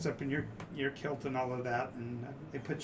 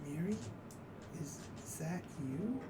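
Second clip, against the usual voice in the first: soft, distant voice of a god; very faint voice; 5 semitones higher, 9 dB quieter than usual. Acted. Game master as a god answering a prayer, very faintly. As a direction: call faintly, as if barely reaching her. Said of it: "very faint voice, you hear".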